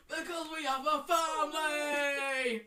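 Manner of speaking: slow, loud melodramatic bellow; absurdly intense husband; intense shouting. Acted. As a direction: stretch it into a high, passionate yell. Continuing the intense man act.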